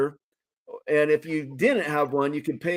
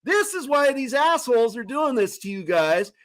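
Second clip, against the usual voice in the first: retold yell at crowd; 11 semitones higher than usual. Telling shoppers in line to drop their masks, high retold rallying yell. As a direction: rouse the whole line like a street preacher. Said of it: "I'm yelling at this guy".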